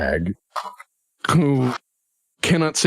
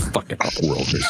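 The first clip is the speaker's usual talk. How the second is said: low grumble, well under usual pitch; grumbling official; 11 semitones lower, 3 dB quieter than usual. Acted. Official grumbling about off-worlders, low mutter. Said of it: "He's like".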